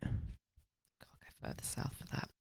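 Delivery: breathy whispered echo of the joke. Pretend. Cohost softly whispers the punchline back in a hushed whisper. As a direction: whisper it back like a secret aside. Echoing cohost's line as aside.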